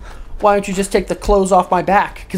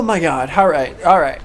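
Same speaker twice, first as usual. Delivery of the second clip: ordinary delivery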